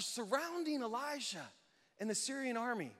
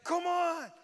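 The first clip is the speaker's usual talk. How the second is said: loud, high rallying yell; hyped-up warrior shout; battle-eager angel; 9 semitones higher, 6 dB louder than usual. Acted. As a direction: goad them into a fight.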